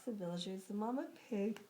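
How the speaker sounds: ordinary delivery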